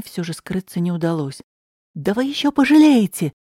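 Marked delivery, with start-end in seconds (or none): 1.9-3.3 громкий злой выкрик; выкрикнуть угрозу во весь голос. Acted. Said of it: "выкрикнул Виталий". (громкий выкрик)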